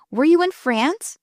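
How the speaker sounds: admiring. A narrator's reading. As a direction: ask with impressed surprise. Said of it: "he said admiringly".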